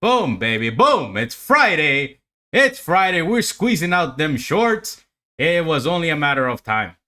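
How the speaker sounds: hyped up. Real, not acted. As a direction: shout it out like the market just paid you. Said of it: "I gotta be hyped up".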